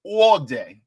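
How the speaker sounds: a light New York accent on "All day". Shown as a demonstration. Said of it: "I gotta say it like a New Yorker".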